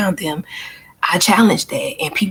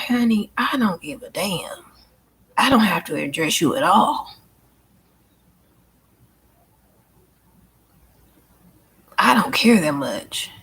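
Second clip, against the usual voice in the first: dismissive brush-off. Retold or imitated. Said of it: "I'm like".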